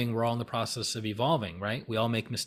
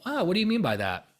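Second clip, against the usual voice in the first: lightly raised, easy questioning; nonchalant, neutral; 6 semitones higher than usual. Shown as a demonstration. Questioning someone who's off the rails, nonchalant and neutral. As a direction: ask it with neutral curiosity. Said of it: "Nonchalant, neutral".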